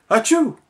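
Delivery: big high sneeze; a loud comic sneeze. A narrator's reading. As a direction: sneeze right in the cat's face. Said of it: "went Mister Putter".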